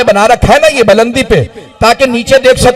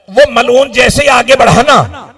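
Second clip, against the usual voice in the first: ordinary delivery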